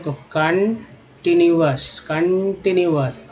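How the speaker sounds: कंटीन्यूअस stretched out slowly, ending in अस; slow demo pronunciation. Shown as a demonstration. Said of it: "ओयूएस को अस बोलते हैं".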